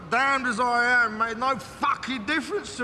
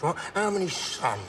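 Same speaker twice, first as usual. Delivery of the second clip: normal talking voice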